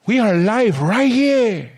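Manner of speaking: high, drawn-out live-TV announcement; Telemundo host. Pretend. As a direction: stretch it out like a Telemundo presenter. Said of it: "a host for Telemundo".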